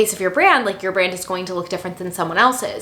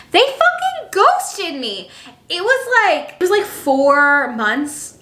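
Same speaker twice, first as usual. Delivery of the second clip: much higher, slow, drawn-out outraged cry; outraged disbelief; 9 semitones higher, much slower than usual. Real, not acted. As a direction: stretch out every word in disbelief. Outraged repeat of the complaint.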